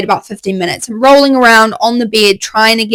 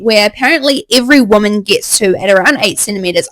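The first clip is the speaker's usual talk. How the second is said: ordinary delivery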